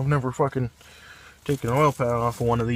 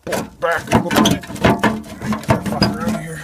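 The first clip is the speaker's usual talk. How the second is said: frustrated straining; 5 semitones higher, 7 dB louder, faster than usual. Real, not acted. Straining at stuck engine part.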